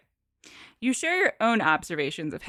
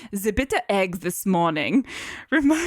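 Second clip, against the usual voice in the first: put-on German accent, doctor character. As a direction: report it as a German scientist. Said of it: "That's German".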